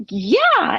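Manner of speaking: high, startled acceptance, imitating the neighbor; shocked. Neighbor answering the offer of fish, acted shock. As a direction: act out a shocked, high-pitched reply. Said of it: "like, shocked".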